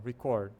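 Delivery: "Record" as re-CORD; final-syllable stress. Shown as a demonstration. Teacher points to emphasis at the end, "Record" stressed late. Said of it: "o ênfase tá no final".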